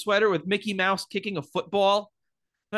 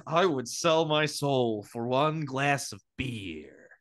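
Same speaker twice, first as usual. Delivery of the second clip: Jack quote; 6 semitones lower, 3 dB quieter, slower than usual. Retold or imitated. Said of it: "he says".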